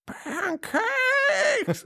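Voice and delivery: very high squeaky hammed-up shout; mock kid yell. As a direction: shriek it like a weird kid. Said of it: "that kid at the end when he's like".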